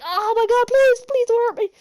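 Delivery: high, loud mock-terrified begging; panicked pleading. Spider-Man begging the Lizard as it jumps from the ceiling, panicked pleading. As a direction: squeal it out as a hammed-up frightened victim. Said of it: "and he's like".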